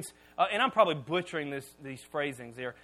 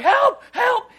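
high, loud yelp for help; comic panicked help call; mock cry for help; 14 semitones higher, 12 dB louder than usual. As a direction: squeal for help under the weight. Crying for help under the bar.

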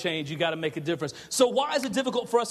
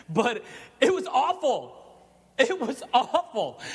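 laughing; 4 semitones higher, slower than usual. Real, not acted. Laughing over the flood disaster.

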